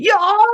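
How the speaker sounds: very high, loud, clipped "Y'all" like a karate shout; karate-yell y'all. Shown as a demonstration. Podcaster yelling "Y'all" like someone doing karate, very high and loud. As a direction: bark "Y'all" like a karate cry. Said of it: "Sounds like someone doing karate".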